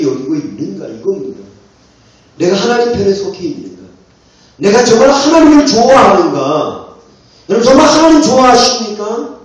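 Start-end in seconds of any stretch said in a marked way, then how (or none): 2.4-9.4 loud, rising challenge to the congregation; insistent pulpit questioning; forceful preaching. Real, not acted. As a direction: press the questions hard, getting louder and higher.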